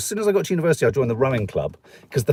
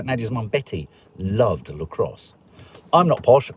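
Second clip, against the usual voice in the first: ordinary talk, nothing marked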